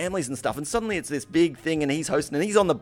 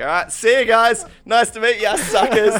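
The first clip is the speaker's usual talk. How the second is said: louder, higher taunting farewell; smug sing-song send-off; mock cheery goodbye; 6 semitones higher, 6 dB louder than usual. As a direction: wave them off smugly. Mocking farewell to guests.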